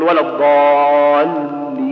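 "ولا الضالين" chanted slowly with the ل flowing only a little; lam balanced. Shown as a demonstration. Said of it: "تقول".

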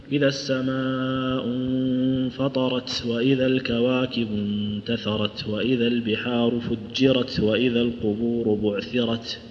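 slow, low, chanted tajweed; measured melodic recital; Quranic recitation. Shown as a demonstration. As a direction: chant the verses slowly on a level tone.

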